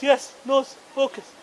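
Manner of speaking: soft, meek replies; impassive staff member. Retold or imitated. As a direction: play the unruffled airline man, quietly deferential. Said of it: "his only job is not to change his face".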